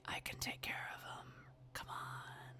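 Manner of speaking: low, whispered urging; hushed beast murmur, coaxing; murmuring inner beast. Acted. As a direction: whisper temptation from inside the head. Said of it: "You hear the murmurings of your beast".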